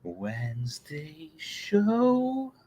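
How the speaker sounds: breathy whispered slow opener; slow hushed show title. Acted. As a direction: whisper it slowly like a secret intro.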